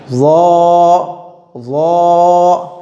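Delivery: "वो" as a heavy Z, rounded and held long; thick-sound demo. Shown as a demonstration. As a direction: hold each "वो" full and level. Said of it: "मोटा लफ्ज़ निकलेगा". ("वो" को मोटा करके खींचें)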